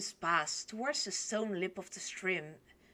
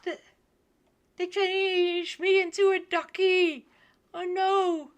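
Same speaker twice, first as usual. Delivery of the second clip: dismayed outcry; 10 semitones higher, 8 dB louder than usual. Real, not acted. Shocked reaction to the story.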